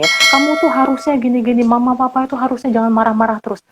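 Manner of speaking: put-on high, accusing whiny child voice; nada menuding. Contoh kalimat menuding. (anak merengek menyalahkan mama)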